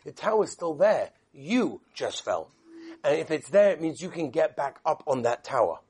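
father soothing his fallen son; gentle father. Acted. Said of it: "And he said".

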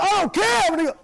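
high, loud defiant outburst; hammed-up reckless shout; bugging out. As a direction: yell it, losing control. Acts someone bugging out.

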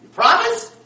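high, loud challenge; sharp rising holler; demanding shouted question. Acted. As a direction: make him swear to it, loudly. Wary roommate demands a promise.

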